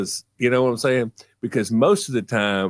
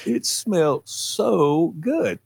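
higher and very slow, savoring "good"; dreamy kid voice; 7 semitones higher, much slower than usual. Retold or imitated. Boy savoring the smell for his mother, slow and high. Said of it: "I said".

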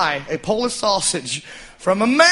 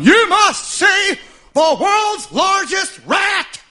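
slow, stretched carnival barker shout, much higher and louder; barker yell; 9 semitones higher, 4 dB louder, much slower than usual. Retold or imitated. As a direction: bellow it like a sideshow barker pulling in a crowd. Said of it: "he was just yelling at people".

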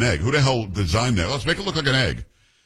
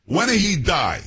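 high-pitched mock Italian accent; thick Italian accent; 7 semitones higher than usual. Bold Italian woman asking about grandfather's death, thick Italian accent. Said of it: "with a thick, uh, um, Italian accent".

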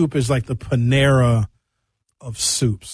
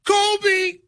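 calling out a name. Retold or imitated. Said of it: "If you don't say either".

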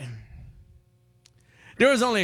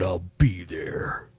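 slow whispered cop line; 10 semitones lower, slower than usual. Retold or imitated. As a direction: whisper it slowly, all drama. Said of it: "talk like a radio game show host".

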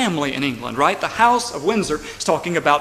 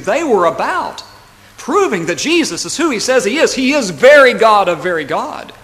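raised, building pulpit proclamation; forceful preaching; 5 semitones higher, 6 dB louder than usual. Real, not acted. Preacher proclaiming who Jesus is, forceful preaching. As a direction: proclaim it higher, swelling louder to the end.

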